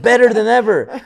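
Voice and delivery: confident brag about how well things are going; upbeat boast. Retold or imitated. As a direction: sell it like business is booming. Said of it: "And I'm like".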